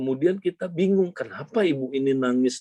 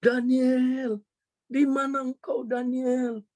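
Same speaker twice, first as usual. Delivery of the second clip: acted searching cry, pitched way up; imitated yelling; 11 semitones higher, slower than usual. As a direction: call out for a lost child, stretching the name. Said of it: "Dia teriak".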